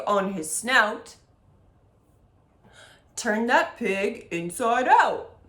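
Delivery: lightly done goofy low voice; a hint of a big silly giant. Shown as a demonstration. Music teacher leading the echo chant in a silly low voice. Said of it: "switch to our silly low voice".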